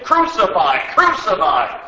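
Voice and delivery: loud, falling chant-like yells; shouting. Acted. Priests and guards seeing Jesus, loud falling shouts. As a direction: bark it twice like a mob. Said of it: "they shouted".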